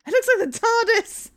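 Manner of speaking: high, loud thrilled cry; excited shout. Acted. The newcomer shouts with joy at spotting the door. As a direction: burst out with delight.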